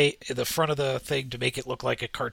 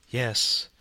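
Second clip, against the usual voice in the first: usual speaking voice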